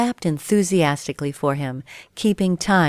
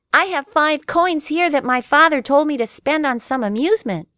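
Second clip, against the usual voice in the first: put-on higher, louder little boy; eager puppet boy; 10 semitones higher, 4 dB louder than usual. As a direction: chirp the fib proudly. Pinocchio tells another lie.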